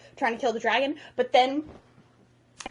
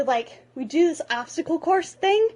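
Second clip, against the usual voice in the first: normal talking voice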